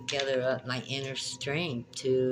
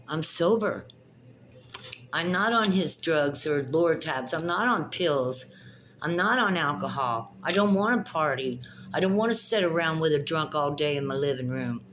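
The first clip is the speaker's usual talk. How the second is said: louder, heated outburst; angry rant; 3 semitones higher, 4 dB louder than usual. Real, not acted. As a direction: vent your anger at him.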